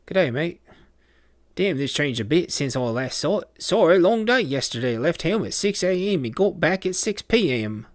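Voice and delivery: loud, high-pitched, hammed-up Aussie accent; bad Australian accent. Player answers a viewer's greeting in a bad Australian accent. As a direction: put on an exaggerated Australian accent. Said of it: "my Australian accent is really bad".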